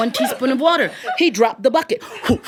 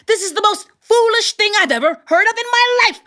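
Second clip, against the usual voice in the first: higher, exasperated outburst; exasperated tailor; 11 semitones higher than usual. A narrator's reading. Tailor scolding his new in-laws, exasperated and higher. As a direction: throw up his hands in disbelief. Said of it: "still screaming at each other".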